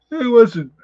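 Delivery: talking through a yawn; words stretched by a yawn. Real, not acted. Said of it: "Already yawning".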